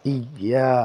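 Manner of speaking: ই ইয়া with the ইয়া held one alif count; ইয়া drawn out one alif length; one-count stretch. Shown as a demonstration. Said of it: "ইয়াতে এক আলিফ টান হবে".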